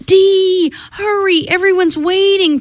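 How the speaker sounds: high, sustained storybook call; sing-out hurry-up call, not shouted. A narrator's reading. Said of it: "Priscilla called from the kitchen".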